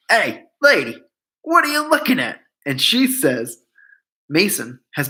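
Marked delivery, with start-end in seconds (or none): 0.1-2.4 put-on brash Corsican accent, pitch leaping up on what. Hot-headed Corsican snapping at the staring Englishwoman, Corsican accent. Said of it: "excuse my Corsican accent".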